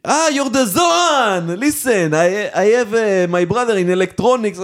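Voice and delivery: high, slow Israeli salesman voice; pushy Israeli macher, high-pitched; Israeli hustler. Retold or imitated. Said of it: "הוא כולו כזה".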